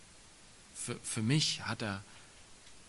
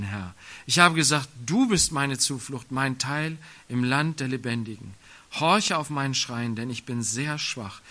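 louder, solemn psalm read to the congregation; scripture reading; 5 dB louder than usual. Acted. Preacher reads Psalm 142 to the church, loud and solemn. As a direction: proclaim the lament with weight.

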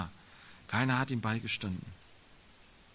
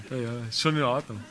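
quick, chuckling through the words; laughing; 2 semitones lower, faster than usual. Real, not acted. Preacher laughs off an interruption, quick chuckle. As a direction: laugh it off warmly.

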